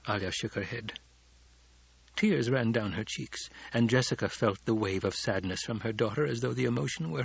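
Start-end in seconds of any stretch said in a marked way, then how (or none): none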